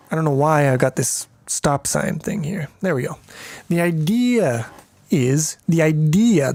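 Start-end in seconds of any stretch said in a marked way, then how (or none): 3.7-6.5 slow, odd idea with a falling end; put-on idea. Shown as a demonstration. Coder mocking his own pronunciation of idea. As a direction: drag out idea, idea in a mock voice. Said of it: "you gotta pronounce it like idea".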